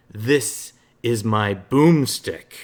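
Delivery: slow, loud cocky hero drawl on "This is my boomstick"; hammed-up Ash line. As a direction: savor every word of "This is my boomstick", cocky and loud. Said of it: "Hellbreaker wants me to read".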